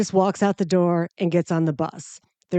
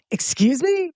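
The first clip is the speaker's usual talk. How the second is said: shocked, pitched-up challenge; indignant high excuse me; 8 semitones higher than usual. Retold or imitated. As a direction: act offended at the driver. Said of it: "and I said".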